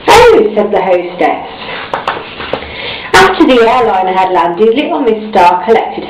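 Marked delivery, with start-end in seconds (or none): 0.1-0.5 high, louder surprised oh. A narrator's reading. The air hostess hears Miss Star's dream, surprised. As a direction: react with mild surprise.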